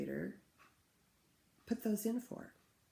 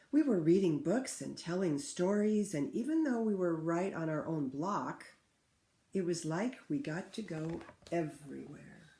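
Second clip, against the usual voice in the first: put-on clear, louder read-aloud for children; story reading; 5 dB louder than usual. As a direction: read the book to the class with feeling. Teacher reads picture book aloud.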